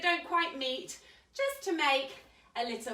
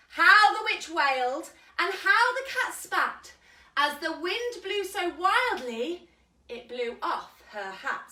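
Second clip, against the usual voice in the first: story reading; 4 semitones higher, 4 dB louder than usual. A narrator's reading. Reading picture book aloud.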